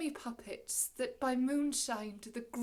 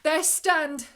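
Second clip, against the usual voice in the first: loud, raised, commanding shout; sharp command; 4 semitones higher, 11 dB louder than usual. Acted. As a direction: fix them to the spot with it. Prospero commands the spellbound men.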